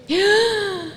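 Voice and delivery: a hammed-up gasp of shock; mock shocked gasp. Mother gasps at her son's words, mock shocked.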